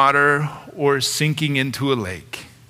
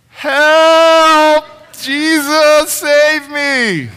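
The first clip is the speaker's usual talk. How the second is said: much higher and louder, a panicked scream; desperate cry for help; 11 semitones higher, 8 dB louder, much slower than usual. Retold or imitated. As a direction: yell it like a sinking man. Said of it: "That prayer of".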